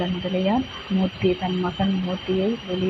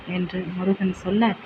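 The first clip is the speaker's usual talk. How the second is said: usual speaking voice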